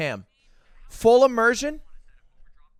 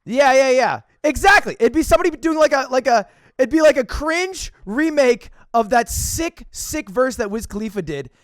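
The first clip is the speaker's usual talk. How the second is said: excited; 5 semitones higher, 6 dB louder than usual. Real, not acted. Hyped agreeing with judge.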